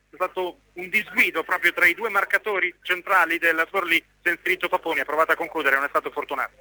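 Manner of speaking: ordinary talk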